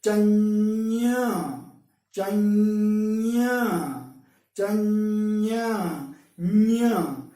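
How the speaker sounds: चन्या with a long hummed ñ opening into ña; held nya released open. Shown as a demonstration. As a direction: sustain the palatal nasal in चन्या, then release it to a vowel. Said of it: "आप इसी को छोड़ दें".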